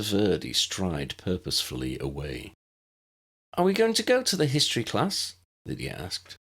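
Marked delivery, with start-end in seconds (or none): 3.5-5.3 put-on high, fast little girl; bright eager child asking; young girl's voice. A narrator's reading. Narrator voices young Lydia.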